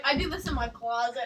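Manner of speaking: low, soft deadpan imitation; mock monotone. Retelling what she said about vocal warm-ups, monotone. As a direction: drone it quietly on one note. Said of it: "in the most monotone voice ever".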